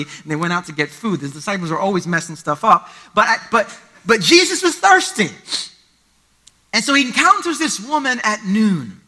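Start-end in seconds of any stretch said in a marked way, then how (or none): 4.0-5.3 thirsty hit high and loud; stress on thirsty. Real, not acted. Preacher stressing that Jesus was thirsty. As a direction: punch the word thirsty.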